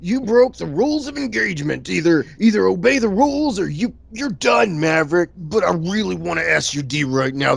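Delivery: stern officer voice that cracks into admiration; stern commander. Retold or imitated. Commander scolding Maverick but secretly impressed, in a lightly put-on voice. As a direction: scold him, then gush.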